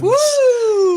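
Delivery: high, loud excited cheer; excited whoop. Real, not acted. Cheering a guest announcement.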